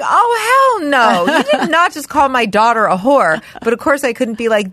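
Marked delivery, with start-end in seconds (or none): 0.0-3.4 indignant, higher-pitched mama-bear protest; retold outrage, punched at the start; mock outrage. Mother retelling her reaction to an insult of her daughter, mock outrage.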